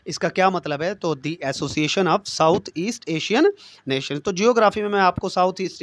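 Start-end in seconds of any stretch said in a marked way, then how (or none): 1.2-4.2 every word drawn out very slowly for note-taking; slow dictation. Shown as a demonstration. Teacher spelling out the ASEAN full name very slowly. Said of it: "ASEAN stands for".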